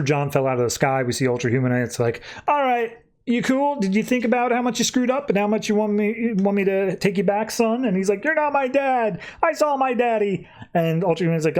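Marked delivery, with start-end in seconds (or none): 2.5-7.8 put-on high childish voice, far higher than his own; kid voice. Recapping the comic, voicing young John as a child. 8.2-10.5 put-on high childish voice, far higher than his own; kid voice. Recapping the comic, voicing young John as a child.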